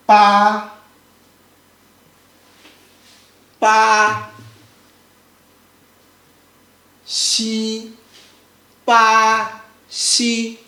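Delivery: BA and XI apart, each held flat; broken-down first tone. Shown as a demonstration. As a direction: spell out ba, then xi, long level syllables. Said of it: "Let me break down for you".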